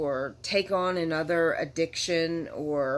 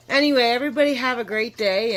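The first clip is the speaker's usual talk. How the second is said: cheerful; 7 semitones higher, 8 dB louder, faster than usual. Real, not acted. Cheerful sign-off.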